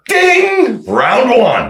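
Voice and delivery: high-pitched fight bell and round call; mock ring announcer. Mimics a boxing bell before fight.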